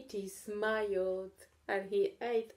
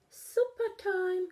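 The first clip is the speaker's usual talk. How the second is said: high, slow sing-song call; 12 semitones higher, 7 dB louder, slower than usual. A narrator's reading. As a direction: call out across the house. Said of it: "called Katy".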